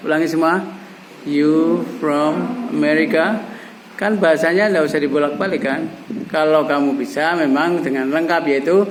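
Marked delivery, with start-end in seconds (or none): 1.3-3.5 slow, measured question for repeating; drawn-out teaching example; slow model phrase. Shown as a demonstration. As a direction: say it slowly for learners to echo.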